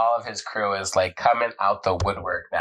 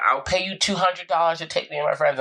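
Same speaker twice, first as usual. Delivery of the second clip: higher-pitched imitation of yelling riders; mock yell; 8 semitones higher than usual. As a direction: call out pleadingly, without real volume. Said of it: "people are, like, yelling".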